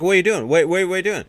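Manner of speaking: high, loud mock panic; freaking out. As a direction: imitate someone frantic and protesting. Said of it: "And Leon's freaking out".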